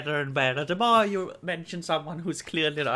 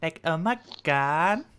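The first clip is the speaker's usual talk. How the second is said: put-on Valley Girl; 2 semitones lower than usual. Shown as a demonstration. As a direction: draw out "God" like a Valley Girl. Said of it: "like, Valley Girl".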